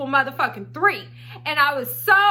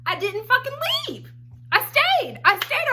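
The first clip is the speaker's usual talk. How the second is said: exasperated; 11 semitones higher than usual. Real, not acted. Exasperated at own foolishness.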